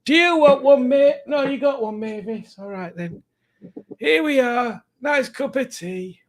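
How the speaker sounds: high, hammed-up old northern man voice. Making tea for the viewers, in a silly old northern man voice. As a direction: play a doddering northern bloke making tea. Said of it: "weird old northern man voice".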